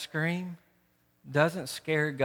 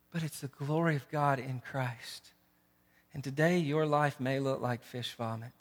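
usual speaking voice

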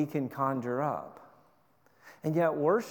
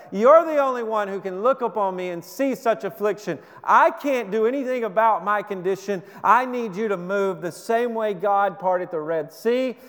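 higher, louder desperate prayer; a mother begging God for a son, higher and louder; Hannah pleading; 5 semitones higher, 5 dB louder than usual. Acted. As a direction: cry out to God as a barren woman with nowhere else to turn.

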